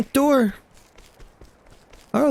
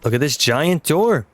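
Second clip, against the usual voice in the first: ordinary talk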